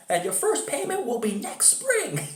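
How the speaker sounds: very high, comical commercial pitchman; Phil the carpet store guy. Retold or imitated. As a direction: hawk it in a squeaky-high mock ad voice. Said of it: "And then he says".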